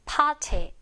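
"party" as "pah-tee", t clearly released; British "party"; British pronunciation. Shown as a demonstration. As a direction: drop the r and stress the t sound in "party". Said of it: "이렇게 발음이 되겠구나".

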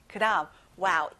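usual speaking voice

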